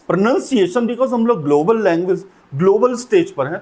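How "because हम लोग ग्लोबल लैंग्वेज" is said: ordinary talk, nothing marked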